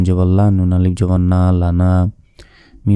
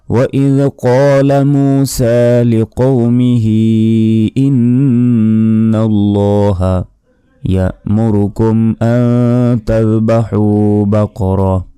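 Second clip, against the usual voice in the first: slow melodic tajweed recitation, a little higher; Quran recitation; 3 semitones higher, much slower than usual. Acted. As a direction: chant the verse slowly and fully. Recites the verse after spelling. (ধীর সুরে কুরআন তিলাওয়াত)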